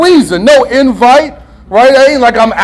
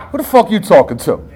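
low, quick retort, not raised; muttered challenge; 4 semitones lower, 4 dB quieter, faster than usual. Retold or imitated. Husband letting anger out at his wife, a low quick retort. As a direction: toss the challenge off low and fast. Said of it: "I'll just say it like that".

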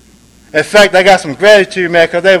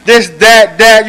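higher, louder berating mother; a mother scolding her son, loud and belittling; mother's insults; 5 semitones higher, 5 dB louder, slower than usual. Retold or imitated. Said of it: "my mother told me".

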